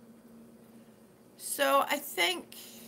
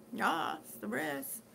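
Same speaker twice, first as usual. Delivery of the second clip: ordinary talk, nothing marked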